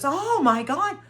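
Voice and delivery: high mock gasp of amazement; stunned daughter. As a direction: imitate the daughter's shocked reaction. Daughter sees the cluttered room.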